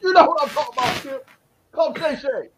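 high falsetto impression; hammed-up, squeaky sportscaster send-up; Shannon Sharpe. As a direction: plead in a comically high parody voice. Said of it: "break out my Shannon Sharp".

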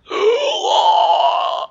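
high, drawn-out gasping cry; choking dying gasp. Acted. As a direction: gasp like someone drowning in blood. Acting a man drowning in blood.

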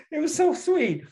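said through fading high laughter; laughing fondly. Real, not acted. Telling daughter about George Martin, still laughing. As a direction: still giggling, fond and delighted.

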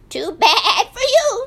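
high, loud singsong; singsong sheep. A narrator's reading. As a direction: sing it like a smug sheep. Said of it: "sang Woolly Sheep".

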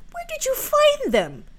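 very high, loud question; astonished demand. Acted. Helen questions Marouckla about the strawberries, astonished demand. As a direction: demand it in shrill amazement.

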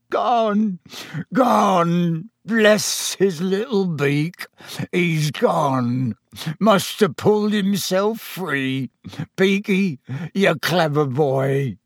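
howling through sobs. A narrator's reading. Owner finds his pet has escaped, sobbing words. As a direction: wail it slowly, overcome with relief. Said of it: "hear Hagrid's words through the sobs".

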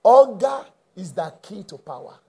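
usual speaking voice

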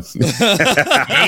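high cackling burst of laughter; high, helpless real laughter. Real, not acted. Laughing about the friend exploding.

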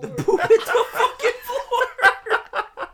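loud, high, slow cackling yell; laughing shout. Real, not acted. Uncle cracking up telling friends, loud laughing shout. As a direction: howl it through laughter.

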